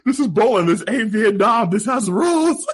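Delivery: high, loud quoted rant; hammed-up indignant yell; mock outrage. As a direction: belt out the line, pitched right up. Repeats Walter's outburst, laughing.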